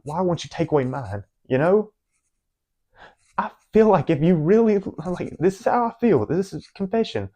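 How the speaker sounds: real upset, voice climbing high; getting emotional. Real, not acted. Hurt speaker confessing doubts to God, emotional and high-pitched. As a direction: plead with God, pitched far up, overcome. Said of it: "I'm gonna get emotional".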